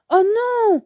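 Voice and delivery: high-pitched cry of dismay. A narrator's reading. Sacha discovers the balloon is gone, dismayed cry. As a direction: cry out at a sudden loss. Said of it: "s'écrie Sacha".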